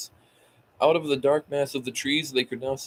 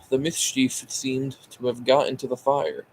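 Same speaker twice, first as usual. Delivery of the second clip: ordinary delivery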